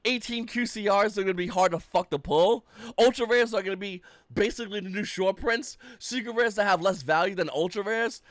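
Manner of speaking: oversell the box like a paid shill; mock hype. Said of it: "and go".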